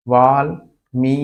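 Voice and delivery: वाल with long aa, pause, then मी; syllable by syllable. Shown as a demonstration. Teacher building the derived word, वाल and मी apart. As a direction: say वाल and मी one at a time. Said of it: "वा हो जाता है".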